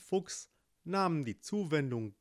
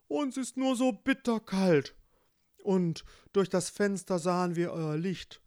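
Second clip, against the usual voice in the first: gentle bear voice, louder, pleading at times high; polite bear; 5 semitones higher, 4 dB louder than usual. Acted. Bear reassures the mother at the door, gentle plea. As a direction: reassure the frightened woman.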